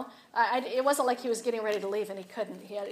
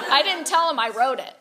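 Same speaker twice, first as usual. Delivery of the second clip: laughing, louder; 5 semitones higher, 9 dB louder than usual. Real, not acted. Preacher laughs telling the congregation about the tract, laughing. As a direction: laugh through the confession.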